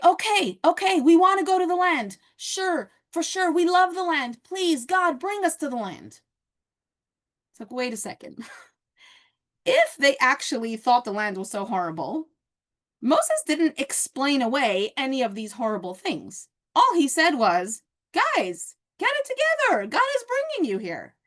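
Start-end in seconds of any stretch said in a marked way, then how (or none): 0.0-6.2 mock eager. Spies suddenly eager to go. 18.1-21.1 high, rousing shake-up call; pep talk. Retold or imitated. Moses rousing the people with a pep talk, high and urgent. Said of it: "a pep talk that just shakes you awake".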